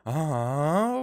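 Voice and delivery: a real jolt of surprise at the name; surprised. Real, not acted. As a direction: blurt it out, caught off guard. Streamer reacts to the legendary codex.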